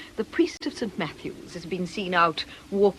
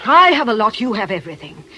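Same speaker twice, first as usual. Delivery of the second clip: louder, stressing I; loud retort; 2 semitones higher, 9 dB louder than usual. Acted. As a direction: throw the I back at him. Aggie retorts loudly.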